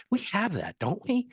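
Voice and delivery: high, sing-song question. Retold or imitated. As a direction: pitch it way up as a question. Asking the librarian agent.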